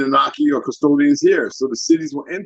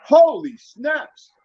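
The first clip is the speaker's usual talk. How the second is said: much higher and very slow, stretched in wonder; amazed exclamation; 10 semitones higher, much slower than usual. Retold or imitated. Caller tells listeners of his big revelation, with a slow, high amazed cry. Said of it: "to be able to say".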